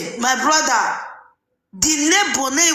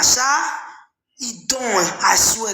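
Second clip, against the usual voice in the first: normal talking voice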